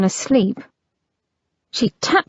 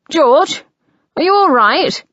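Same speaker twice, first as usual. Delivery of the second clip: calling out; 7 semitones higher, 4 dB louder than usual. Acted. Brother calling through the door.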